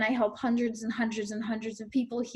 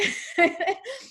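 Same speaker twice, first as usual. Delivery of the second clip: short high rueful laugh; 10 semitones higher than usual. Real, not acted. Laughing at own fancy degree.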